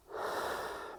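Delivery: slow audible exhale; releasing breath out. Shown as a demonstration. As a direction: let a long breath go. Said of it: "and a releasing breath out".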